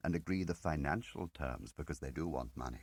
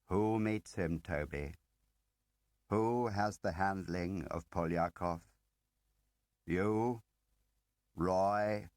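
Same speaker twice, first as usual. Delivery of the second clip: louder, firing the questions one by one; pressing interrogation; 4 semitones higher, 5 dB louder, slower than usual. Acted. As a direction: corner him with each name. Smiley presses Toby with questions.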